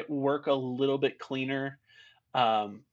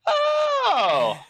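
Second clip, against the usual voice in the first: long high shouted "oh", falling at the end; loud cry of "oh"; 8 dB louder than usual. Retold or imitated. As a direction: belt out a startled, drawn-out yell. Retelling his reaction to the scene.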